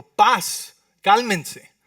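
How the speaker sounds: commanding. Acted. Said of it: "les dijo".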